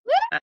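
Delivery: very high, loud "woo"; celebratory squeal of a whoop; excited whoop. Real, not acted. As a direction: whoop for the student. Celebrating a student's attempt.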